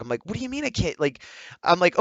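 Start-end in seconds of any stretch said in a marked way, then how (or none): 0.3-1.0 high-pitched, softer disbelief; incredulous retort. Retold or imitated. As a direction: question it as if it's absurd. Acts out disbelief at refusal.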